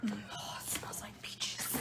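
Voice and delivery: slow, unvoiced whisper. Real, not acted. Smelling her tea for viewers, soft whisper. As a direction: hush as you smell it.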